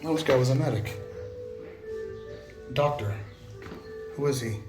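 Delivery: usual speaking voice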